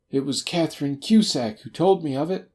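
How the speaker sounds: high, strained confession; frightened man's crackly voice; crackling voice. A narrator's reading. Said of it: "said he in a crackling voice".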